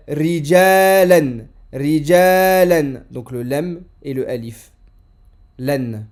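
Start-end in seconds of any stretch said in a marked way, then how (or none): 0.1-3.0 Rijalan slow and loud, the final an clearly nasal; deliberate classroom model of Rijalan; slow model reading. Shown as a demonstration. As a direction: land clearly on the tanwin of Rijalan. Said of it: "Parce qu'il y a tanwin".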